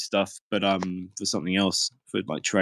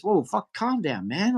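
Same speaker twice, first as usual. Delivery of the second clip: higher, slow placating voice; mock calming; 9 semitones higher, slower than usual. Jail worker calming an angry inmate, slow and placating. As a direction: settle him down, drawn out.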